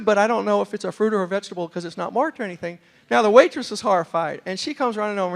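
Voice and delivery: ordinary talk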